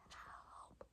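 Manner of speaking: barely audible, voiceless cry for help; strangled whisper of help; unable to scream. Retold or imitated. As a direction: mouth a mock scream with no voice. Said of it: "I couldn't scream".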